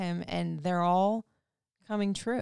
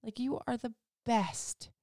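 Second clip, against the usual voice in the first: tender awe; 4 semitones higher, 7 dB quieter than usual. Retold or imitated. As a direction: say it quietly, moved by your son. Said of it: "I'm just like".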